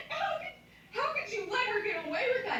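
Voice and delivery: very high, incredulous squawk with a laugh; mock outrage. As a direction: act out laughing, high-pitched indignation. Acted indignant reply in retold quarrel.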